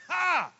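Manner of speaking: one big high burst of laughter; God's triumphant laugh. Pretend. Preacher voicing God laughing from glory, one loud high laugh. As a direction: bark out a single joyful "Ha!". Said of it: "He's sitting in glory going".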